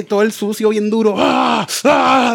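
high shouted cries of pain; pained shouts. Retold or imitated. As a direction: yell like the scrub hurts. Reenacting scrub pain.

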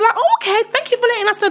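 very high, courteous reply; mock polite thanks. Quoting the families' polite reply.

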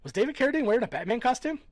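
high, loud, fast disbelieving question; can't believe what he just heard; incredulous. Real, not acted. Asking a friend about Carradine, high incredulous.

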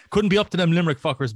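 ordinary delivery

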